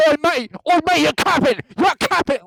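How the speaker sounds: high, loud mock British shouting; British arguing. Mocking British people arguing, hammed-up British shout. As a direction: shout it like a cocky British kid in a fight. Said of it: "British people arguing".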